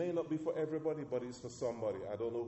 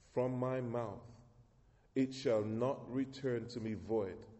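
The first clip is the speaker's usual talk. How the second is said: slow, weighty reading of the verses; solemn scripture reading; 3 semitones lower than usual. Acted. Reads closing scripture aloud.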